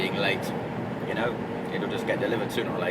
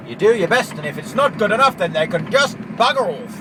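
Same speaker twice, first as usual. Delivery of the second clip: louder, higher, defiant; defiant outburst; 9 semitones higher, 6 dB louder than usual. Real, not acted. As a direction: shout it out like you don't care. Defiant rant.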